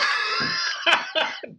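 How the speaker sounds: high unvoiced burst of laughter; breathy laugh. Real, not acted. Reacts to punchline.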